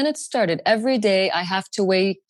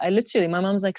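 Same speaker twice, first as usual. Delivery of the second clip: ordinary talk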